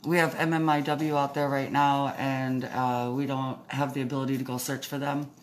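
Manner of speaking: muffled through a face mask; talking through a mask. Real, not acted. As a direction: speak muffled behind a mask. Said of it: "It's probably hard to understand me".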